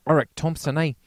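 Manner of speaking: loud mock Kiwi voice; New Zealand accent. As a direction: keep the Kiwi bit going. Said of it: "you do your New Zealand".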